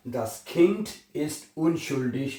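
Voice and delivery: "Das Kind ist unschuldig" spoken slowly, word by word; teacher models the full German sentence slowly for students. Shown as a demonstration. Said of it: "तो ये जुमला बनेगा".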